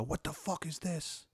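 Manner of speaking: slow, startled guard; a guard barking at an intruder. Acted. Armed guards confront player.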